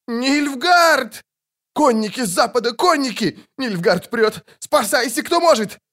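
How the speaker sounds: very high panicked shouting; wild panicked cry. A narrator's reading. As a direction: scream a warning to the whole camp. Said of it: "дикий крик".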